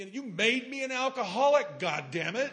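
loud, laughing mock scold; mock outburst. Son teasing his crying mother, loud mock scolding. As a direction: tease her with a loud fake rebuke.

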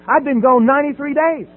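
ordinary talk, nothing marked

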